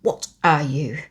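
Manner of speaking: snapped. A narrator's reading. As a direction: bite back hard at the whining boy. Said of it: "snapped Mary".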